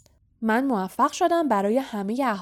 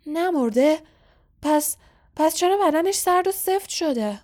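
higher-pitched, halting anguished question; distraught disbelief; 8 semitones higher, 4 dB louder than usual. Acted. Caretaker doubting his cat is alive, distraught. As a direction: question it in distress, stumbling on the repeat.

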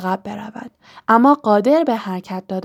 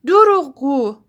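higher-pitched accusing cry; sudden shout; 5 semitones higher, 3 dB louder than usual. A narrator's reading. Harry suddenly cries out at Voldemort, a higher, accusing shout. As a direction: burst out with a short, mild shout. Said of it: "ناگهان هری فریاد زد".

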